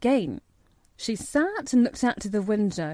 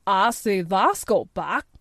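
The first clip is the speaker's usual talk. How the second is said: broad Yorkshire station master; gruff, good-natured Yorkshire; Yorkshire accent; 3 dB louder than usual. A narrator's reading. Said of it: "pronouncing his words in a queer, broad fashion which Mary found out afterward was Yorkshire".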